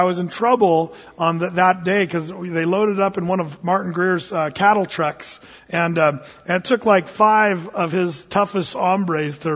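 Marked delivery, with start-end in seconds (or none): none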